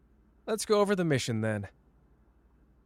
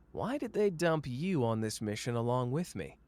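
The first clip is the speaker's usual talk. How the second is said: ordinary talk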